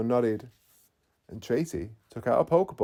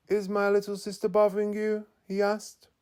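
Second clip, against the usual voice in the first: put-on higher voice for the young man; a teasing older brother checking in; 10 semitones higher, 4 dB louder than usual. Older brother walking in on the guests, teasing.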